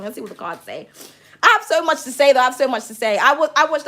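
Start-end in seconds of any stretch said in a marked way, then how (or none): 0.0-0.9 hushed aside. Acted. Reader murmurs to herself before drawing cards, hushed. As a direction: drop to a soft, hushed aside.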